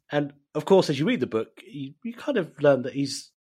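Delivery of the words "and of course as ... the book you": ordinary talk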